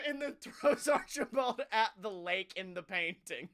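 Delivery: normal talking voice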